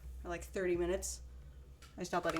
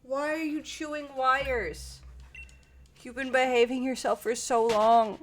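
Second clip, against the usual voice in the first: slow, exasperated loud scolding; yelling at cat; 3 semitones higher, 7 dB louder, slower than usual. Real, not acted. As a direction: scold the cat loudly, drawing out the words. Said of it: "me just yelling at a cat".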